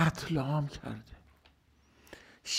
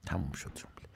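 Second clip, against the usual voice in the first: whisper; 7 semitones lower, 9 dB quieter than usual. Real, not acted. Soft aside ending the point.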